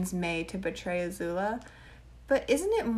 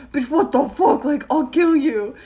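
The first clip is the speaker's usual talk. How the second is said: loud, higher furious snap; mock angry threat; 6 semitones higher, 10 dB louder than usual. Friend acting out Suki threatening Sokka, loud mock fury. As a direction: blow up at him as Suki.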